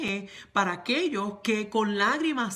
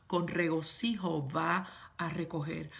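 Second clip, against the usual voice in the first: ordinary talk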